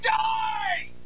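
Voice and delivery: very high, drawn-out shriek; hammed-up high shout; mock battle cry. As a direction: scream it like a cartoon attacker. Mock attack on the work.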